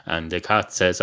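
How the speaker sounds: ordinary talk, nothing marked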